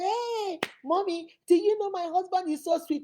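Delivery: high-pitched, gushing praise; gushing daughter. Retold or imitated. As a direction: gush to mom like a giddy girl.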